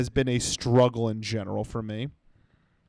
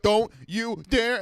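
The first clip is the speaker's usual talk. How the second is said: slow, stern warning from his own body; a hint of a stern threat; inner warning; 6 semitones higher, slower than usual. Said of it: "my entire body being like".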